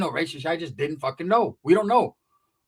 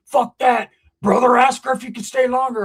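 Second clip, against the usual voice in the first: loud, higher, insistent acted voice; pushy outburst; 6 semitones higher, 6 dB louder than usual. Friend pushing for a whole weekend away, insistent. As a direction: burst out urging him on.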